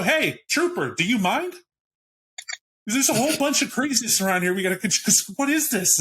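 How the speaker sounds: high, agitated cop voice; panicking cop. Acted.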